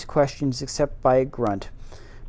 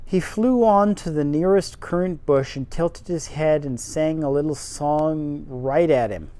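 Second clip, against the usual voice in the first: ordinary delivery, nothing marked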